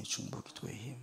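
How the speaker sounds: soft echo. Shown as a demonstration. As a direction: let the phrase 중보 기도의 힘 fall to a near hush. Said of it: "quiet repeated emphasis".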